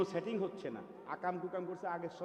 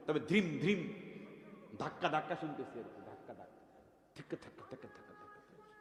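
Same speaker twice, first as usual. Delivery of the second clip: soft mimicked drum beat through earphones; faint thumping music sound; imitated earphone thump; 5 dB quieter than usual. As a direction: tap out the muffled beat softly, fading away.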